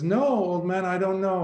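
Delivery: acted alarm. Young scholar admits to boatman he can't swim, acted alarm.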